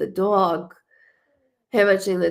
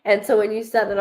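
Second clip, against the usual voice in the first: usual speaking voice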